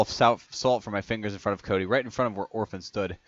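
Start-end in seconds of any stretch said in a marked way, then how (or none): none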